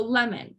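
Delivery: "lemon" with LEM stressed and "mon" reduced to a schwa. Shown as a demonstration. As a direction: lean on LEM, let "mon" fall away. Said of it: "You hear the emphasis in the lem part".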